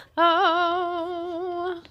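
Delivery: sharp delighted gasp; excited gasp. Real, not acted. Host finding the author's signature, delighted gasp. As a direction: gasp in real delight at the autograph.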